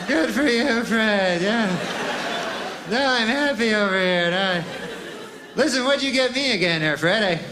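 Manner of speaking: a hint of strained friendliness; fake cheer. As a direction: hide the envy behind brightness. Forced cheer to rich friend.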